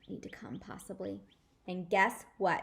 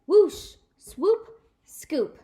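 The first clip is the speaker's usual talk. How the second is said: sound effects; 9 semitones higher, 12 dB louder, much slower than usual. Acted.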